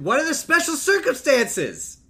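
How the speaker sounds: high, loud, slow-stretched demand; exasperated shout. Real, not acted. Co-host challenges Ben's special circumstances, exasperated shout. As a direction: shout it in disbelief. Exasperated outburst at character's line.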